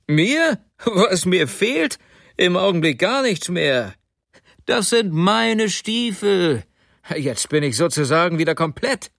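high, exasperated sarcasm; indignant outburst. A narrator's reading. Husband telling his wife these are his boots, indignantly. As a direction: drag out the key words in outrage.

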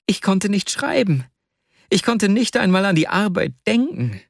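high, agitated confessional voice; Wasja, unburdening himself. Acted. Wasja unburdens his heart to Arkadi, agitated.

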